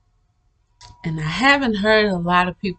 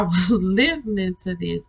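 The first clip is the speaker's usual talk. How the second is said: ordinary talk, nothing marked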